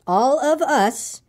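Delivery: very slow, high, deliberate delivery; stretched, measured pronouncement; slow and careful. A narrator's reading. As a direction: spell it out word by word. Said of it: "very slowly and carefully".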